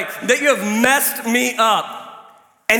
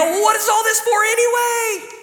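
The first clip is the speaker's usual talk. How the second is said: mock despairing shout, much higher; exasperated outcry. Pastor voicing a weary man, exasperated shout. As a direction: throw up your hands and cry out.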